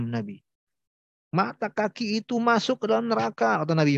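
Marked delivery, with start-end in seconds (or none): 1.3-3.6 raised warning voice. Retold or imitated. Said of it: "dengan suara yang tinggi".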